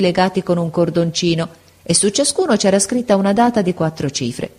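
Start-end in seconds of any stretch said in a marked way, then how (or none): none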